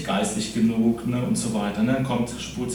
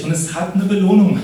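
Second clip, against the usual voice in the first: higher and louder, driving the point home; emphatic proclamation; 7 semitones higher, 6 dB louder than usual. Real, not acted. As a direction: proclaim the payoff with a rising surge of energy. Preacher drives home the key point. (nachdrücklich und begeistert verkündet)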